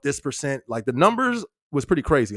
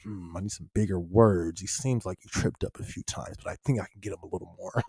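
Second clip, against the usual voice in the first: lower, softer sly voice, half unvoiced at the middle; mock scheming chatter; 8 semitones lower, 6 dB quieter than usual. Streamer imitates a viewer plotting harder words, sly and hushed. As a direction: play the viewer plotting to trip him up. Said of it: "She, she's like".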